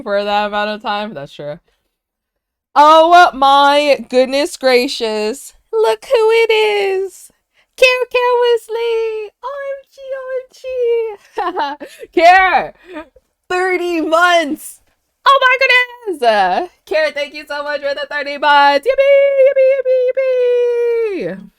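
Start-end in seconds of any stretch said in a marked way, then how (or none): 2.7-17.1 very high, loud, drawn-out squeals; excited squealing. Real, not acted. Celebrating a sub anniversary. 17.1-18.9 high, loud excited thank-you. Real, not acted. Celebrating a sub anniversary. 18.9-21.5 very high, loud, drawn-out yippee; squealing cheer. Real, not acted. Cheering a sub anniversary.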